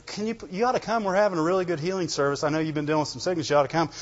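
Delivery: low, quick, softly mumbled coaxing; half-hearted invitation. Acted. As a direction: rattle off a lacklustre invitation under your breath.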